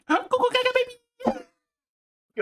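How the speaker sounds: very high squeaky cheering. Acted. Cheering on baby Mario bit.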